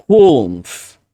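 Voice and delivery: "Holmes" with the L swallowed, said the British way; "Holmes" sin sonar la L, pronunciación británica. Shown as a demonstration. ("Holmes" con la L casi muda)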